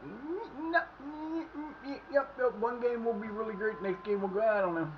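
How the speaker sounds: high, soft mock hemming and hawing; mocking the team. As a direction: play a lazy, undecided team with a shrug. Mocks the team's sluggish attitude.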